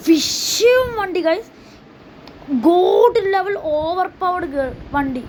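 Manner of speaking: high, slow, drawn-out hype over the car; awestruck, slow showcase of the Lamborghini to viewers; hyped reveal. Real, not acted. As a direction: stretch every word like a grand reveal. Showing off the car.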